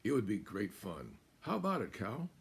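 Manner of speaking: high, loud, coaxing fox voice; sly little fox. Acted. Fox luring Cow to explore the countryside, high and coaxing. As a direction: tempt her like a playful little fox. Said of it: "asked the fox".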